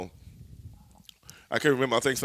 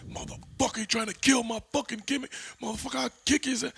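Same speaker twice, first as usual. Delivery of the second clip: retold angry outburst pitched way up; mock angry Savage; 12 semitones higher than usual. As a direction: imitate a wrestler's rage, squeezed high in the throat. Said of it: "he's mad".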